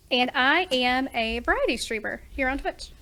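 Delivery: usual speaking voice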